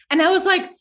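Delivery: high-pitched retold shock. Remembering her coach calling her a martyr, high shocked. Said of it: "I was shocked".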